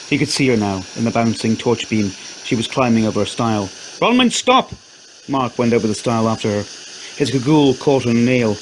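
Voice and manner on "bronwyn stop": calling out. Acted. Husband chasing wife in rain.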